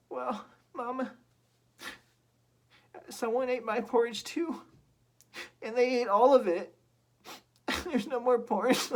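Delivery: very high, whimpering little bear; squeaky little bear close to tears; tearful baby bear. Acted. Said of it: "on the verge of tears".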